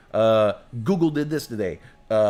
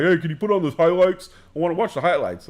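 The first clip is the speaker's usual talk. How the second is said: higher, louder whiny request; imitating a pushy customer; 4 semitones higher, 4 dB louder than usual. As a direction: mimic an annoying guy asking for sports. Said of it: "people all the time who are like".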